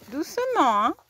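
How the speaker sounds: ordinary talk, nothing marked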